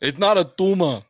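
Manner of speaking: put-on high, loud, emphatic Austrian accent; Arnold Schwarzenegger impression. Friend quoting Arnold Schwarzenegger in his accent. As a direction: hit "not" hard like Arnold. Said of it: "Give me Arnold".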